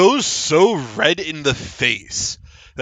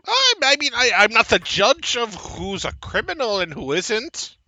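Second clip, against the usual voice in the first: evasive halfling; 7 semitones higher than usual. Acted. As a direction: hedge defensively as the halfling. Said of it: "He looks at you. Go".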